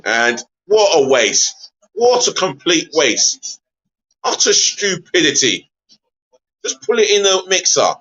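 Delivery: slow, disbelieving groan over a wasted chance; drawn-out despair at the striker; exasperated. Real, not acted. A streamer fuming at a striker who wasted the chance, slow and exasperated.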